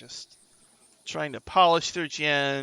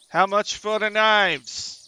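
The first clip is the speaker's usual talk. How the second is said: higher, slow, loud shopper; passer-by customer; 7 semitones higher, 6 dB louder, slower than usual. Acted. Passer-by asks the kobold seller his price, loud and slow. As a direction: call out the question, drawing it out. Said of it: "one person does walk up".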